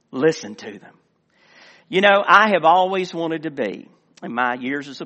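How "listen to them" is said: low, calm, savoring the sound; a gentle, appreciative reply; Jesus. Acted. Jesus delighting in children's praise before the Pharisees, calm. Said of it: "And Jesus said".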